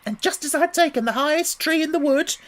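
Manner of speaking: indignant pigeon building to a shriek; rising shriek. A narrator's reading. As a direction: work up toward a shriek. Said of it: "raising its voice to a shriek".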